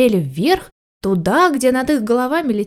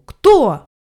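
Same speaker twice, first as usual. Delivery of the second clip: louder, higher one-word demand; angry shout; 4 semitones higher, 6 dB louder than usual. A narrator's reading. Cat demands answer from puppy and kitten, angry. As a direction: fire out a cross, raised question. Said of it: "закричал кот".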